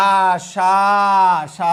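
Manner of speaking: আশা with a long, loud final vowel; open syllable held. Shown as a demonstration. Teacher showing a word ending in a vowel, আশা drawn out. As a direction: stretch the end of আশা, then hold সা. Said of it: "আমরা এরকম বলি".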